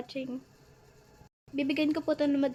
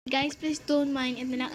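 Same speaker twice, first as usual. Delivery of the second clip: usual speaking voice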